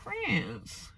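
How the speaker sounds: high, soft mock scoff; small high dismissive voice; twisted-lip disdain. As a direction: imitate a twisted-lip, doubtful question. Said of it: "you did the twisted lip".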